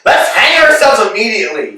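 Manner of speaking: bursting out loud with a sudden plan; eager outburst. Acted. Proposing hanging to his friend, loud and eager. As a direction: blurt it out, thrilled with the idea.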